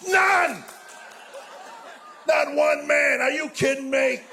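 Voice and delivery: comic outburst of disbelief; outraged shout. Acted. Comedian to men who never trashed wives' clothes, outraged shout. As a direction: shout it in mock outrage at the crowd.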